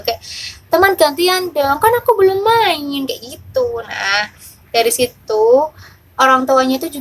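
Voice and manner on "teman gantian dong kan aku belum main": put-on higher-pitched child asking for a turn; imitated child pleading. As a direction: imitate a little kid's plea to a playmate. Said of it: "dia juga bilang sama temennya gitu loh". (menirukan anak meminta giliran dengan nada tinggi)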